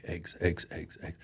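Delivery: very soft repeated mumble, fading out; muttering to himself. Retold or imitated. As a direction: mutter it under your breath to remember it. Repeating list to himself in store.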